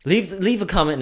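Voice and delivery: high, loud announcer voice; hammed-up host plug; mock announcer. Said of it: "no, I'm just kidding".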